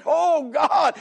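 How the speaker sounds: high, drawn-out cry of prayer; preacher acting an anguished cry to heaven; crying out to God. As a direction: call out to God as if overwhelmed. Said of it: "he's crying".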